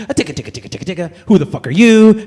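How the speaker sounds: clicked ticka ticka, then a loud rising who the fuck are you; typing then yelling. Acted. He answers an unknown number, ticking then shouting. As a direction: tap it out, then yell the question. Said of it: "And I'm like".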